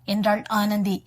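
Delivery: ordinary delivery, nothing marked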